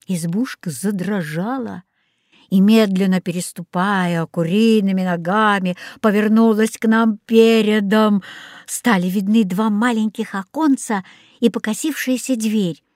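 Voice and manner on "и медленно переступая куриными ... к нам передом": usual speaking voice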